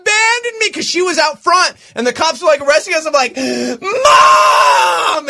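high, loud tearful wailing; snotty crying. Retold or imitated. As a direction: sob it out like an abandoned kid. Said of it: "snotty crying".

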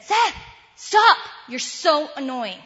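high, loud exasperated outburst; nasty snap. Retold or imitated. Mother snapping at her repetitive son, nasty and loud. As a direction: snap it loudly, fed up with the nagging. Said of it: "responded really nastily".

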